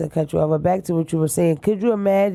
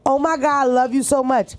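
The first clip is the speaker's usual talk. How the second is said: gushing fan; 7 semitones higher than usual. Retold or imitated. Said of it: "people go to my live and like".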